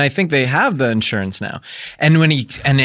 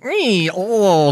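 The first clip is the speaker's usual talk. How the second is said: higher, silly goofy squeal; 6 semitones higher than usual. Shown as a demonstration. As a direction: make a dumb goofy noise. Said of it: "goofy, like".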